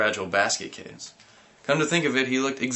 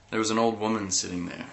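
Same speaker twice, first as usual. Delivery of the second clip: usual speaking voice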